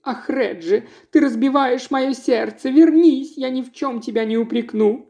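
wife begging her husband to return; pleading wife. Acted. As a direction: plead with him to come back.

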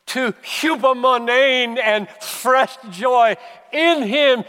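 loud, high, impassioned pulpit preaching; preaching at full force. Acted. Pastor urging his congregation toward the commands, preaching at full force. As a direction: pound the point home to the congregation.

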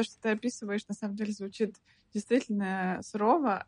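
ordinary delivery

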